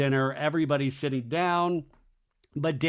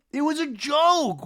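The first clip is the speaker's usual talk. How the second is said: higher, slow whiny insistence; mock defensive; 13 semitones higher, 5 dB louder than usual. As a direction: push the excuse even higher. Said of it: "Jesse Solomon was like".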